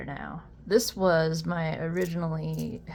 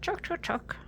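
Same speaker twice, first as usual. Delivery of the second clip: squeaky chuck chuck chuck, much higher, a bit softer; high mock click noise; 11 semitones higher, 6 dB quieter than usual. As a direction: mimic the click in a tiny high voice. Imitating slider noise, high.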